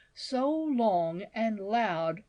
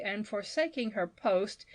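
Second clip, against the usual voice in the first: ordinary delivery, nothing marked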